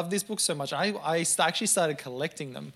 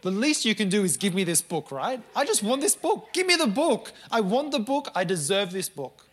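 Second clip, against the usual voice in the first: loud, high-pitched, rising to a shout on give me the book; a teenager shouting at nan for his book; angry demand; 5 semitones higher, 4 dB louder than usual. Retold or imitated. Demanding the book from his nan, in an angry shout. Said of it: "But I was angry".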